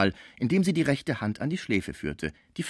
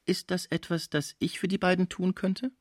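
hohe, leise Unschuldsfrage; harmlos unschuldig; 9 semitones higher, 3 dB quieter than usual. A narrator's reading. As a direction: ask softly and high, all innocence. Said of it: "mit der harmlosesten Miene". (ganz harmlos gefragt)

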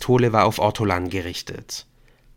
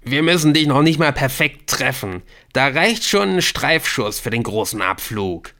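loud, higher taunt; raucous, loud jeer from a thug; jeering bawl; 5 semitones higher, 5 dB louder than usual. A narrator's reading. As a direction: bawl a threat at the captive. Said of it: "gröhlte es".

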